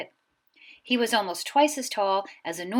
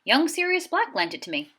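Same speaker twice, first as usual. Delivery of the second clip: higher lightly voiced giant; a big friendly giant explaining; Hagrid; 8 semitones higher than usual. A narrator's reading. Hagrid explains the motorcycle, lightly voiced giant.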